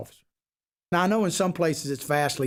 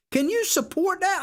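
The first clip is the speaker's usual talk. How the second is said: high incredulous demand; 12 semitones higher than usual. Real, not acted. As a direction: voice leaps way up on the question. Challenging Democrat voters on air.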